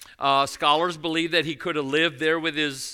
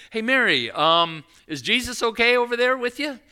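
calling out; 7 semitones higher than usual. Retold or imitated. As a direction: call across the crowd for his wife. Joseph calls to Mary.

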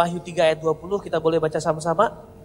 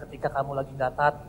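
usual speaking voice

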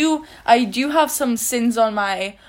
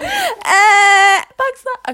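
excited shriek; 10 semitones higher, 5 dB louder, slower than usual. Real, not acted. Excited teasing shriek at friend.

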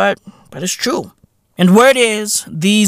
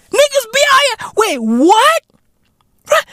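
mock disbelief. Vlogger acting stunned at the rename. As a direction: act stunned.

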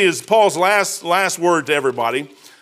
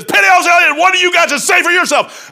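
higher, louder furious captain's shout; captain yelling; 11 semitones higher, 6 dB louder than usual. Retold or imitated. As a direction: chew the sailor out at full volume. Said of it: "red in the face and steam".

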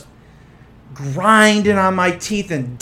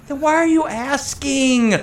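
higher, drawn-out mock exasperation; retold exasperation; 7 semitones higher, 4 dB quieter, slower than usual. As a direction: stretch the question out in disbelief. Retold exasperated reply to assistant.

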